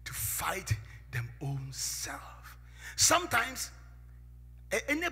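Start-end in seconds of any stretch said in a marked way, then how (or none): 0.1-2.6 whisper. Real, not acted. Preacher drops to whisper.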